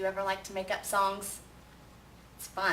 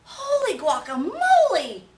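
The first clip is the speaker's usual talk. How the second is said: slow, very high and very loud startled cry; exclaiming; 11 semitones higher, 11 dB louder, slower than usual. Acted. Kitten startled by his reflection in the mirror. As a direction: yelp it in shock. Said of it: "exclaimed Skippy John Jones".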